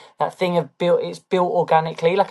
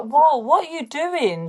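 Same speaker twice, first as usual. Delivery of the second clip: high, drawn-out "whoa" of disbelief; catching yourself out, pitched high; mock alarm; 8 semitones higher, slower than usual. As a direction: pull yourself up in exaggerated, slow disbelief. Acted reproach to herself about filter.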